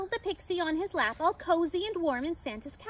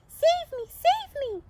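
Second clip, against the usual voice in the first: high, loud little cry for help; pixie's shout; 12 semitones higher, 6 dB louder, slower than usual. A narrator's reading. Tiny pixie pleading to be saved, high shout. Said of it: "the stars heard him shout".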